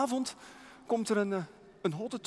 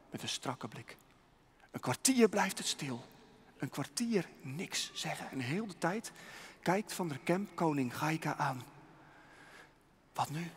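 ordinary delivery

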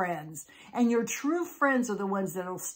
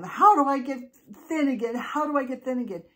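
high, loud, frantic demand; screaming; 5 semitones higher, 6 dB louder, faster than usual. Acted. Molly screams at the witches, demanding a cure. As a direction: yell it at them, rushing the repeat. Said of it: "Molly just screamed at them".

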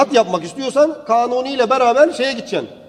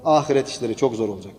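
low, quick, grieving voice of Osman; lightly voiced weeping caliph; a hint of a mournful old caliph; Osman's lament; 10 semitones lower, 6 dB quieter, faster than usual. Osman voiced, weeping over hereafter.

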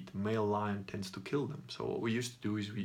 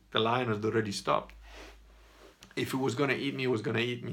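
usual speaking voice

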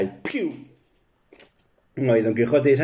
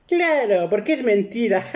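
wife's punchline; 9 semitones higher than usual. Retold or imitated. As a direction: land the joke as the wife. Wife delivers punchline. (remate en voz muy aguda de esposa)

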